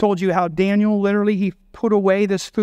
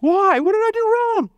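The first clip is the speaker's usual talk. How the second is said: much higher, whiny question; hurt protest; 14 semitones higher than usual. Retold or imitated. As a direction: play the wounded child asking why. Voicing a hurt complaint.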